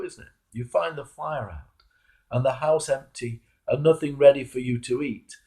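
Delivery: ordinary talk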